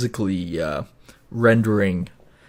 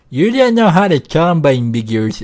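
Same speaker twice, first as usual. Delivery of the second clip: mock heckle; 6 semitones higher, 8 dB louder than usual. Mocking imagined viewer comments.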